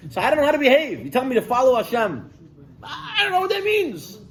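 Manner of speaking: high, pleading mock complaint; acted bewildered whining; exasperated protest. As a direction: throw up a helpless objection, voice climbing high. Acted complaint of confused believer.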